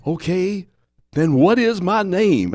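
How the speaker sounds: high, loud, drawn-out demand; Muhammad Ali. Retold or imitated. Champ pressing the old man, high loud insistence. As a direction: ring it out like a proud champion. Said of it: "And Ali goes".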